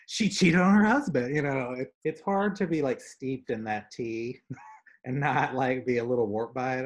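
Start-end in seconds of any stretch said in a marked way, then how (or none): 0.1-1.3 juicy gossip. Retold or imitated. Acting out gossip twist.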